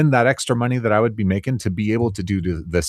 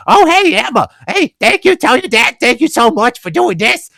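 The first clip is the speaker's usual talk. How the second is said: high, bright cartoon crocodile voice, loud and cheery; Silly Crocodile voice; 13 semitones higher, 6 dB louder than usual. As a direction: play the kids' cartoon narrator for a little girl. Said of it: "you got Silly Crocodile, the narrator, in there".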